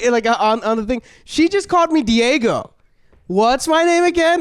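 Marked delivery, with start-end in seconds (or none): 1.2-4.4 high, mock-outraged announcement; hammed-up public scolding; indignant boss. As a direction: demand an answer from the whole room. Acted indignant boss shaming staff.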